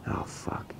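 breathy whisper of sudden horror; whispered dismay. Acted. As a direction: whisper it as the mistake sinks in. Realizes the money all goes to one account.

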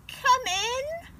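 put-on high old granny voice calling out; high, sweet granny call; Grandma Bear calling. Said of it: "called Grandma".

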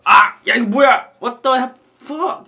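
hammed-up yell of disgust after the drink; shocked outburst. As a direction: spit it out like you just tasted something foul. Acting shock at a bad drink.

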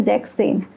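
जेक्सेन, with the double s merged into one; S हटाकर उच्चारण. Shown as a demonstration. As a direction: say जेक्सेन as one joined word, dropping the extra s. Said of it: "तो एस हट जाता है". (जेक्सेन, बीच का एस हटाकर)

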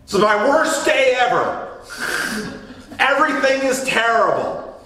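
high, melodramatic wail of a teen's bad day; dramatic older sister. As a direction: ham up the sister's despair, half laughing. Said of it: "My older sister is very dramatic".

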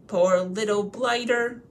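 slow, loud, sated troll; full, pitying troll. Acted. William, full of supper and beer, pitying Bilbo. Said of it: "as much supper as he could hold".

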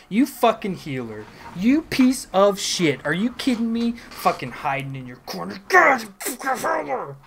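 loud, high put-on fury; staged rage. Trapped gamer cursing the healer, hammed-up rage. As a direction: rant at the healer, not really mad. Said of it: "I'm really not that mad about it".

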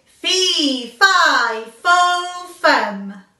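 loud, slow booming chant; loud stomping giant roar, one word at a time; giant's shout. Acted. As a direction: boom each word like a giant. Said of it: "the giant shouted".

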